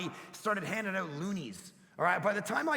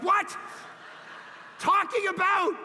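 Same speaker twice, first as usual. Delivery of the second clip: loud, high startled What; surprised dad; 9 semitones higher, 3 dB louder than usual. Retold or imitated. Dad startled by his daughter's request, loud and high. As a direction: bolt up in disbelief. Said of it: "I'm like".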